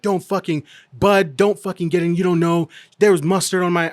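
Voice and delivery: mock the aggressive old man; imitating angry man. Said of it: "he gets in my face".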